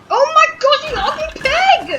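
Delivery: high, loud outraged scream; furious shriek. Real, not acted. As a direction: shriek at the enemy in rage. Enemy takes his house.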